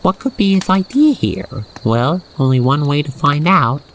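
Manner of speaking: plain reading voice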